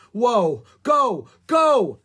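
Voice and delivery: high, loud panicky urging; alarmed call. A narrator's reading. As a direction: shout to send her running. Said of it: "Jack called to Annie".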